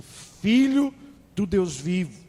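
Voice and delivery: a loud, rising cry on Filho; Peter's bold confession; proclaiming. Retold or imitated. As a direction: proclaim it like a revelation. Peter proclaims Jesus the Christ.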